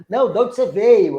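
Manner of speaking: insistent driver. Retold or imitated. Taxi driver doubting her origins, mock insistent. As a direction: press the question, imitating a doubtful driver.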